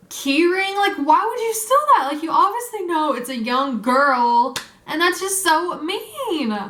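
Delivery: high-pitched, indignant complaint. Retold or imitated. Young victim scolding whoever stole her keys, indignant and high. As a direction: plead with outrage, pitch climbing, drawing out the last word.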